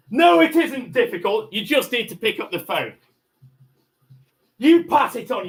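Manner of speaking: loud, high, exasperated yell; furious shout. Real, not acted. Man yelling at the negotiator to pass it on, furious. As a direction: bark it at them, fed up.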